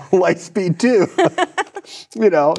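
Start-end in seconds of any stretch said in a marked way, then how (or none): 0.1-1.1 laughing. Retold or imitated. Mock courtroom reveal, laughing. 1.1-2.1 high laugh. Real, not acted. Laughs at own courtroom bit.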